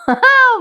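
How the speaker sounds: short high laugh; delighted laugh. Real, not acted. Reviewer enjoying the serial's scene, delighted laugh. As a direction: let out a high, amused laugh.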